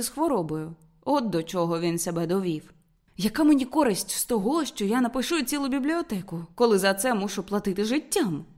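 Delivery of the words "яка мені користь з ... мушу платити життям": plain reading voice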